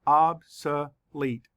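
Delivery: slow, OB-so-lete split into syllables. Shown as a demonstration. Teacher counting syllables for students, slow and split. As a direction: tap out Obsolete slowly beat by beat. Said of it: "Let's count the syllables".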